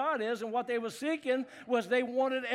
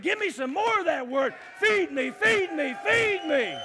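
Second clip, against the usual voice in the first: loud rising cry for more, higher and louder; hungry shout; 7 semitones higher, 7 dB louder than usual. Retold or imitated. Crowd begging for the word, loud shout. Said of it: "they ran, and they said".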